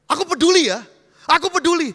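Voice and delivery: bentakan keras bernada tinggi; peduli dengan intonasi salah. Acted. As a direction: ucapkan kepedulian seperti membuli. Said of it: "Intonasinya salah". (mengaku peduli sambil membentak)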